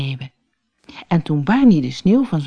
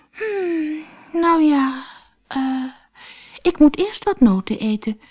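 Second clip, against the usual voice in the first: dithering squirrel; 11 semitones higher than usual. Acted.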